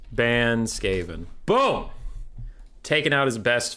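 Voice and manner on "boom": triumphant boom. Real, not acted. Banning the rival's best faction.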